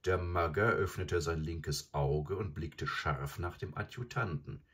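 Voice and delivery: ordinary delivery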